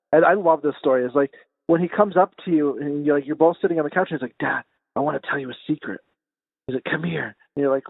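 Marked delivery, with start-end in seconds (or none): 4.4-7.4 soft, low imitation of a little boy with a secret; secretive child. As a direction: confide it softly, like a kid luring dad in. Retold child sharing a secret.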